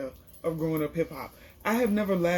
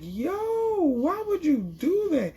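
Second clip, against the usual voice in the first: high, drawn-out incredulous wail imitating a crowd; shocked outcry; 10 semitones higher, much slower than usual. Everybody reacting to the cake knocked off the table, shocked. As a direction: stretch it out in mock horror, way up high.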